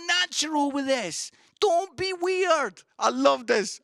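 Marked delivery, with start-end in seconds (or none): 1.6-2.8 slow, high-pitched urging; emphatic exhortation. Real, not acted. Preacher exhorting the church, slow emphatic high plea. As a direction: drag it out as a raised-voice plea.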